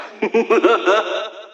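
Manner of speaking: smug laugh. Acted. Villain laughs after taunt.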